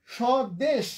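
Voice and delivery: স্বদেশ said শদেশ, stopping dead on the final শ; স্বদেশ with the tongue halting at the end; pronunciation demo. Shown as a demonstration. Teacher demonstrates to students, closed final consonant. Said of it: "থেমে যাচ্ছে, জিহ্বা থেমে যাচ্ছে".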